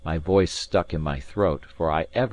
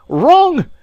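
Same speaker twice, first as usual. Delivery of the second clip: high, very loud cry of fury; furious outburst; 14 dB louder than usual. A narrator's reading. Alan rounds on the maid who asked what's wrong, furious. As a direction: burst out in fury, pitched right up. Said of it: "with a kind of fury".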